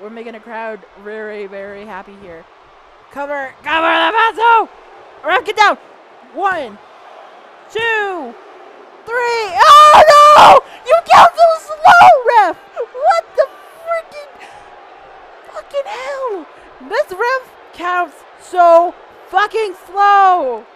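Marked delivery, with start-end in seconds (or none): 3.1-9.5 loud, excited pin count rising in pitch; fired-up shouted countdown; urgent shouting. Real, not acted. 9.6-16.5 very high, screamed disbelief, easing at the end; shrieking gamer rage; outraged screaming. Real, not acted. Raging at slow referee. 16.9-20.7 loud, high, slow complaint; drawn-out angry yelling; exasperated shout. Real, not acted. Complaining about slow referee.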